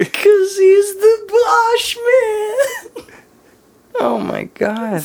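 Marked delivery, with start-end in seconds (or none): 0.0-3.1 high, loud stretched-out squeal; excited reveal. Real, not acted. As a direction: blurt it out high and drawn out.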